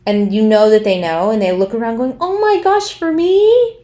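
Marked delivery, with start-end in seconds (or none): 2.2-3.8 high-pitched, hammed-up fake astonishment; feigned surprise. Mimicking people at their own surprise parties, fake astonishment. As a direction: gush as if you never saw it coming. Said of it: "people who plan their own surprise parties".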